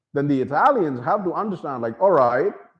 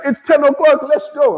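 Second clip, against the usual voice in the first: high, louder insistent mock girl voice; prim German girl; 13 semitones higher, 8 dB louder than usual. German girls cutting the night short, mocked high and loud. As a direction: chirp the deadline like a strict schoolgirl. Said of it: "they'd be like".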